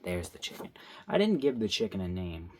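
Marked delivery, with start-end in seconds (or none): 0.0-0.7 whisper. Real, not acted. Aside while drawing.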